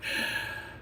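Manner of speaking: sharp acted gasp; mock gasp. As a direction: gasp as a staged distraction. She fakes alarm as distraction.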